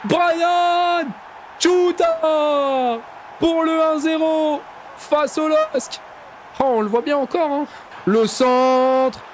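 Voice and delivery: fast, excited French match commentary; live play-by-play. Acted. Gamer calling his own match as commentator, excited play-by-play. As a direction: call the attack like a TV commentator.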